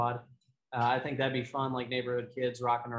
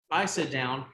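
usual speaking voice

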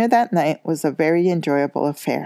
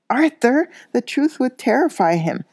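shrill; 8 semitones higher than usual. A narrator's reading. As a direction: cry out his name in alarm. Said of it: "said Missus Weasley shrilly".

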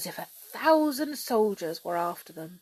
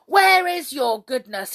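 loud, raised royal demand; angry demand; 5 semitones higher, 11 dB louder than usual. A narrator's reading. King grown angrier than ever, demanding. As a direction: demand it furiously. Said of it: "he demanded".

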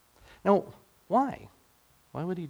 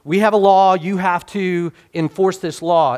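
pushy demand; 6 semitones higher, 8 dB louder than usual. Retold or imitated. Leaders demanding Pilate enforce their law, loud and insistent. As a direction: press the governor hard with the law.

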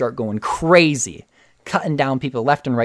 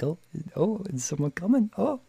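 put-on higher, softer small-child voice; curious little Grogu; 8 semitones higher, 6 dB quieter than usual. Grogu peeking over the chair at the door, curious child voice. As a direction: peek out wide-eyed and wonder.

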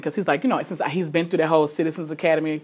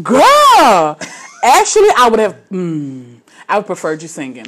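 exasperated retort; 3 semitones higher, 10 dB louder, slower than usual. Retold or imitated. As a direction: burst out, then settle into the complaint. Mock protest in retold reaction.

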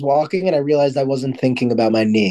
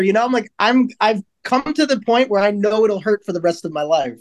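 ordinary delivery

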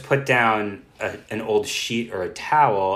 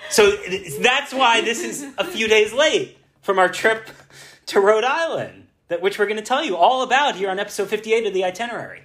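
podcast intro; 11 semitones higher, 3 dB louder than usual. Acted. Show intro announcement.

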